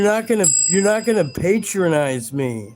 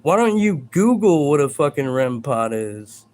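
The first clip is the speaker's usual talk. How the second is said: normal talking voice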